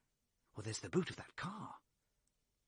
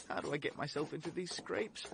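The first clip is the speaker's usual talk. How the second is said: self-reproach; 7 semitones higher, 6 dB louder than usual. Acted. Cornered man berates himself.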